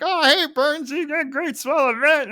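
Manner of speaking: put-on high, loud drunken gush; Lenny. Co-host imitating the tipsy employee greeting his boss, drunk and excited. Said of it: "who's a little bit drunk".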